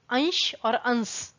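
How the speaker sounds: अंश with स, then अंश with श, drawn out; slow contrast. Shown as a demonstration. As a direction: set the स and श in अंश against each other slowly. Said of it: "अंश को अंश बोलेंगे". (अंश और अंश, स और श का फ़र्क धीरे से)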